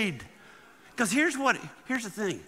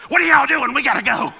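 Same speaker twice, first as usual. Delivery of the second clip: higher, much louder frantic mother; hurried mom yelling; 6 semitones higher, 10 dB louder than usual. Retold or imitated. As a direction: call it down the stairs like a rushed mom. Mama bursts in, hurrying family.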